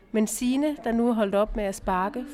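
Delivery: usual speaking voice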